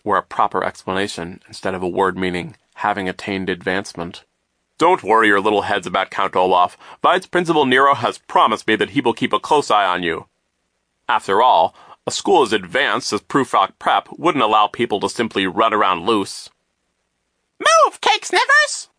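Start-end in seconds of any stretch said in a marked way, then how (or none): 4.8-16.5 loud, emphatic, self-satisfied adult voice; booming, swooping reassurance to children; pompous banker figure. A narrator's reading. 17.6-18.9 very high, loud, drawn-out screech; bratty high-pitched shriek; rude little girl. A narrator's reading. Rude violent girl shouts passing by.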